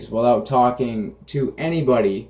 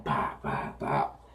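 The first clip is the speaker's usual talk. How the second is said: whispered pop noises; 10 semitones lower, 12 dB quieter, slower than usual. Retold or imitated. Imitating toilet flush noise.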